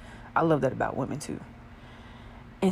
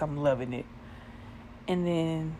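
ordinary talk

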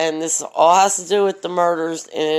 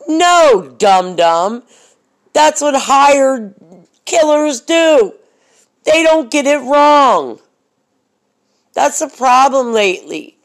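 angry scolding; 7 semitones higher, 7 dB louder than usual. Real, not acted. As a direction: scold them hard, hit every word. Scolding rant at careless killers.

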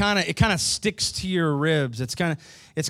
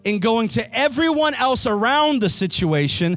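loud, high preaching to the church; 5 semitones higher, 4 dB louder than usual. Real, not acted. Pastor preaching to his congregation at full force. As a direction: preach it out, rising with fervour.

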